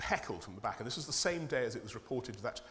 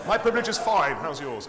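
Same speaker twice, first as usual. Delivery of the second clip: jokey defiance; 5 semitones higher, 12 dB louder than usual. Real, not acted. Joking retort.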